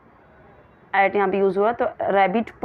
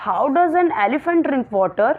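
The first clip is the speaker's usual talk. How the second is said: slow, clear classroom read; slow reading aloud; 4 semitones higher, much slower than usual. Shown as a demonstration. Teacher reading the question to her pupils slowly. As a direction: read each word out very slowly.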